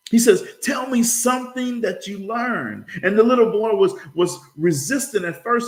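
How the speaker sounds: high, animated storytelling; folksy story narration. Acted. Boy comes home for the holiday, animated storytelling. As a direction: build up the joke with lifted pitch. Tells humorous Louisiana story.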